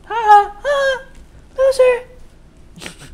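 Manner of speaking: high falsetto jeer; playful cackling taunt in falsetto; mock taunting. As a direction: tease in a silly, very high voice.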